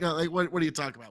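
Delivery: ordinary talk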